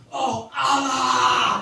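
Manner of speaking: high, slow, loud drawn-out cry; mock climax shout. A comic mocking Muslims in bed, hammed-up climax cry. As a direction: belt it out like a climax.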